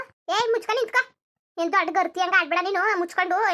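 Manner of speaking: loud, high, furious order to shut up; angry scolding. Acted. Mother-in-law loudly ordering the daughter-in-law to shut up, furious.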